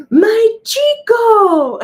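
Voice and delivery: gasp, then a high, loud, drawn-out wail; frantic emotional cry. Retold or imitated. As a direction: imitate an overjoyed owner crying out for a beloved pet. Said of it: "she's a little frantic".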